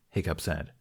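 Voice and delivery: usual speaking voice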